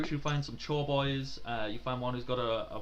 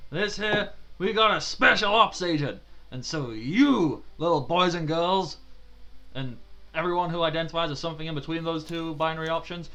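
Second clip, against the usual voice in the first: higher, loud barking address to the troops; drill sergeant speech; 4 semitones higher, 6 dB louder than usual. Acted. Sergeant introducing special ops agent to recruits, loud bark. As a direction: announce it like a tough instructor.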